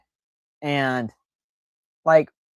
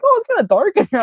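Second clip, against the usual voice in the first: nonchalant; 7 dB louder, faster than usual. Retold or imitated. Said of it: "like, nonchalant".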